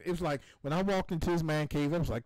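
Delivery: usual speaking voice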